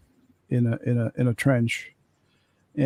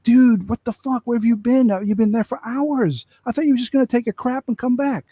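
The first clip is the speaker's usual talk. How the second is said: much higher, fast, indignant rant; incredulous outburst; 10 semitones higher than usual. Retold or imitated. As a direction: demand where he's been all this time. Soldier berates buddy for hours away.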